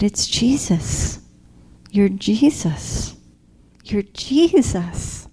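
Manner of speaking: slow, hushed awe, each word drawn out; awed recognition. Retold or imitated. Dreamer recognizing Jesus in front of her, awed and slow. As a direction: slowly realize who stands before you.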